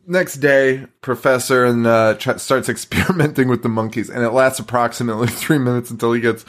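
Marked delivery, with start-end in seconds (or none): none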